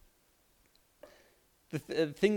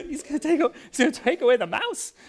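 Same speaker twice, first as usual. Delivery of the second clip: high, louder mock panic; worried kids; 12 semitones higher, 5 dB louder than usual. Boys in the car fearing dad cancels Disneyland, high mock panic. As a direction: gasp it like scared little boys.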